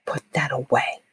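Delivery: whispered, tense warning to put the camera away; nervous coworker whispering put it away; urgent whisper. Retold or imitated. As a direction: hiss it under your breath so the cop won't notice. Nervous coworker hisses at narrator.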